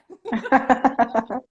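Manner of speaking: loud, delighted laugh; happy laughter. Real, not acted. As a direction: laugh with joy. After cheering second winner.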